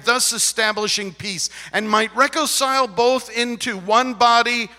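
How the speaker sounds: high, loud preacher's reading; forceful scripture reading. Acted.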